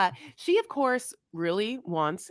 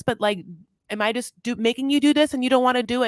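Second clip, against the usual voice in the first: anxious pressing; 4 semitones higher, faster than usual. Retold or imitated. Puppy anxiously pressing reluctant Eric.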